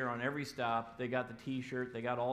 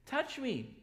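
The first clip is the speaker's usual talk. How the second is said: high eager look at my hands; eager show; 9 semitones higher than usual. Pretend. Jesus showing his wounds to disciples, high and eager. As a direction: coax them to look, raised. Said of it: "he goes".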